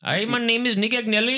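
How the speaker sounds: playful mock Indian accent. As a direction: introduce yourself in a comic Indian accent. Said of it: "my favorite one is Indian".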